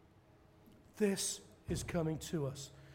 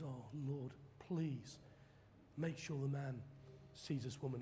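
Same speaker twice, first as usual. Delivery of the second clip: much softer, low murmured prayer; hushed aside prayer; 3 semitones lower, 11 dB quieter than usual. Retold or imitated. Preacher quietly praying the rich man notices her, hushed. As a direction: slip the prayer in under breath.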